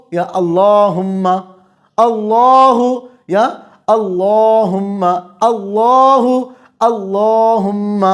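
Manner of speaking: اللہم chanted very slowly in recitation style. Shown as a demonstration. Teacher chants the word examples slowly for students. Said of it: "جن الفاظ کو رکھا گیا ہے وہ یہ ہیں".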